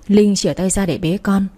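usual speaking voice

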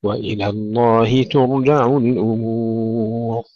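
slow, melodic tajweed chant; Quran recitation. Shown as a demonstration. Teacher reciting the verses for students, slow chanted recitation. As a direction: chant it slowly, drawing out the long vowels.

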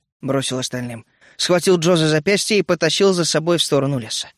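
usual speaking voice

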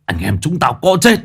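angry, rising to a high shout; hissing with rage. A narrator's reading. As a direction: spit it out in fury. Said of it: "Ông rít lên".

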